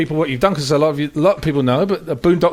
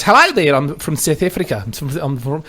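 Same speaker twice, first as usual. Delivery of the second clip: badly faked South African accent; hammed-up South African greeting and chat; attempted South African accent. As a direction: imitate a South African driver, clumsily. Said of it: "an embarrassing attempt at a South African accent".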